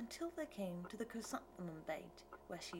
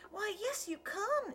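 much higher, louder eager cry; 14 semitones higher, 5 dB louder than usual. A narrator's reading. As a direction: burst out with eager delight. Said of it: "Eliza cried".

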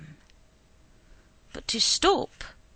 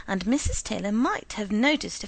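usual speaking voice

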